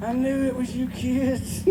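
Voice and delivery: a caught cartoon crook muttering; Scooby-Doo villain. Scooby-Doo villain unmasked quote.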